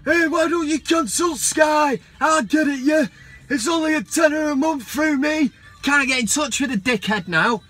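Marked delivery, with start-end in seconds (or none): 0.0-5.5 high, raised put-on seller's voice; hammed-up hawker pushing a deal; salesman impression. Disco Dave selling a dodgy box, hammed-up impression.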